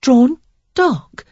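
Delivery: high puzzled question; confused echo of a strange phrase. A narrator's reading. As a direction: repeat it baffled. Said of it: "she repeated in confusion".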